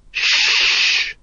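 long hissed hush; urgent shushing. A narrator's reading. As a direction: hush them with a drawn-out hiss. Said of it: "hissed Nobby".

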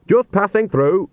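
put-on high, slow, sharp old woman; brisk sharp rebuke. A narrator's reading. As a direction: snap it out sternly. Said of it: "she said sharply".

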